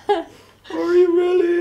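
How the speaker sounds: high, loud, drawn-out squeal; imitated overjoyed mother. Mother learns son is in Richland, imitated joy. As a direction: wail it slowly in high, loud delight.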